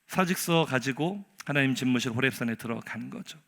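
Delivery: usual speaking voice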